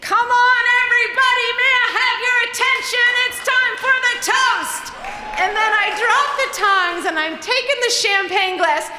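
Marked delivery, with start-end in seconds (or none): 0.0-4.8 high, loud party announcement; announcing shout. Retold or imitated. Narrator shouting a toast announcement to the Hollywood party. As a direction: call the whole party to attention.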